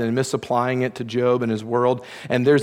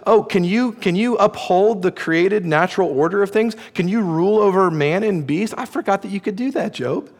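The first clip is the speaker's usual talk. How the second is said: high, teasing mock questions; sarcastic God; 6 semitones higher than usual. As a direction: rub it in with fake wonder. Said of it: "God is very sarcastic to Job".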